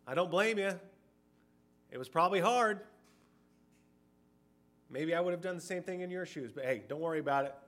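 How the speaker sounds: high, loud, breezy brush-off; mock forgiving. Mock lenient Jesus excusing Peter.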